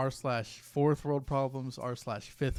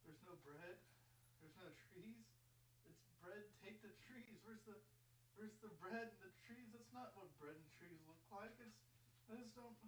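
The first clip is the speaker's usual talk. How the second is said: tiny baffled voice; 9 semitones higher, 27 dB quieter than usual. Acted. Baffled at the posts.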